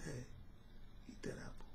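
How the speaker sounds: whispered low command; soft unvoiced urging; smooth serpent. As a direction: hiss it in a whisper. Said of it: "that serpent was smooth".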